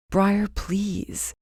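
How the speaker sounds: pleading. Acted. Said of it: "Zach pleaded".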